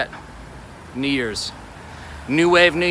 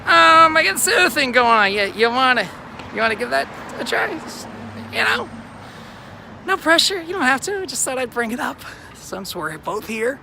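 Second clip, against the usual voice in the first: high-pitched, awkward offer to a date; acted casual pitch; 9 semitones higher than usual. Guy pitching matchmaking to a failed date, high-voiced. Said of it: "I could just say".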